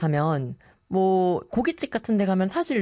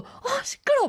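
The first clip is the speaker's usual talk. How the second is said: much higher, irritated grumble; annoyed outburst. Retold or imitated. Host at a noisy barbecue place, irritated outburst. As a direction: snap at the noise with a huff. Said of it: "막 계속 이러고 있는 거예요".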